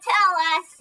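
ordinary talk, nothing marked

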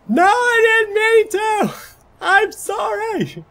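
mock desperate inmate yelling; voiced yelling. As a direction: shriek the apology, stretching every word. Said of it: "stop yelling at each other".

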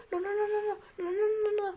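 high, rapid string of noes; high panicky repetition; panicked refusal. Real, not acted. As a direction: stammer out no in panic. Panic after losing a life.

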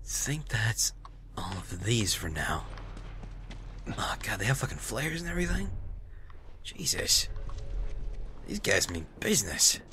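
low, soft talk to self; quiet, wary near-whisper; hushed stealth muttering. Real, not acted. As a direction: keep it under your breath. Quietly scouting armed enemies.

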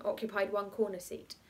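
ordinary delivery

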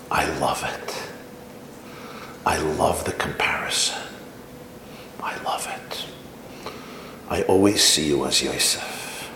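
soft, low, near whispered comfort; tender. Retold or imitated. Father comforting his weeping son, softly. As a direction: hush it to soothe your son.

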